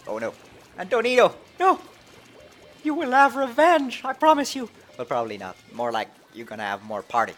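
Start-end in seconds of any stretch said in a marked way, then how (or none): 0.8-1.8 loud high cry of dismay; anguished shout. Real, not acted. Teammate splatted. 2.8-4.7 high, loud hammy oath; dramatic vow. Pretend. Mock vow to fallen player.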